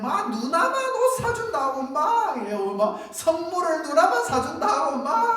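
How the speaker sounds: high, loud imitated tantrum; whining jealous child. Preacher imitating a child jealous of older sister, whining. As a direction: wail the complaint like a jealous little sibling. Jealous younger sibling complains about presents.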